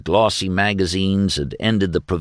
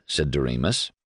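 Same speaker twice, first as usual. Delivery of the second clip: ordinary delivery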